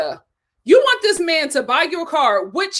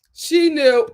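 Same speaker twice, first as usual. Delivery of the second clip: ordinary talk, nothing marked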